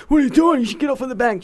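retold rude shout at the mother; imitating angry man. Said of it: "getting angry at her".

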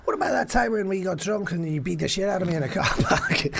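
teasing shout full of laughter; laughing. Real, not acted. Teasing friend about a fight.

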